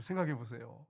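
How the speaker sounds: ordinary talk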